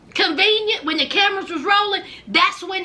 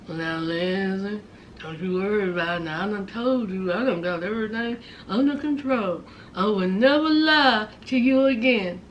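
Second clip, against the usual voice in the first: mush-mouthed Blaine; 9 semitones lower, 5 dB quieter than usual. Retold or imitated. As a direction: mumble low like a mush-mouthed cartoon character. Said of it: "sounding like somebody from the Fat Albert gang".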